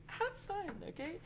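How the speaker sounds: high, bright cheer; excited. Real, not acted. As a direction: cheer them on. Cheerful closing rule.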